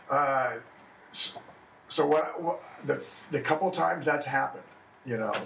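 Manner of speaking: ordinary talk, nothing marked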